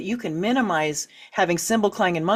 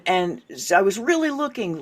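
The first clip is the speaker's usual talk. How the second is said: ordinary talk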